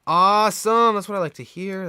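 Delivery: loud, high happy cheer; excited. Real, not acted. Cheering friend playing Mario Kart.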